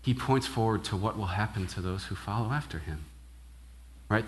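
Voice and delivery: ordinary talk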